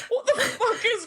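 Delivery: high, laughing disbelief; incredulous laughter. Real, not acted. Co-host cracking up at the film's plot, incredulous laughing. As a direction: squeal it through real laughter.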